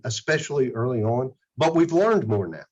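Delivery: ordinary talk, nothing marked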